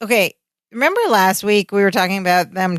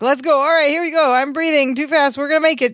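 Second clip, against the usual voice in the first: high, breathless-sounding flurry; acted frantic hurry; mock panic; 7 semitones higher than usual. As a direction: tumble through it in a high flustered voice. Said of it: "Yes, he yells out".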